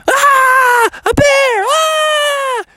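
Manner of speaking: very high panicked screaming; high-pitched hammed-up shriek of alarm; mock terrified scream. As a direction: scream in comic horror at a bear. Campers see bear and flee.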